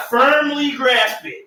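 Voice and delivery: slow, high and laughing; laughing meme quote. Pretend. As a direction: drawl it out through laughter. Quoting meme line, laughing.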